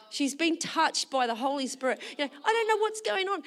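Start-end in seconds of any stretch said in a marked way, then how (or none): 2.4-3.4 imitated crying, high pitch; high mock-weepy voice; tearful mother. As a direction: wail it like a bewildered weepy mum. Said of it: "And she's crying".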